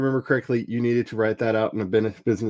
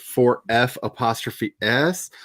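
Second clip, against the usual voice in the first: slow spelled-out phrase; slower than usual. Shown as a demonstration. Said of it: "spelling it out".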